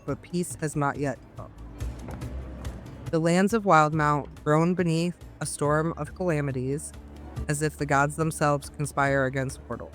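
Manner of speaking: ordinary talk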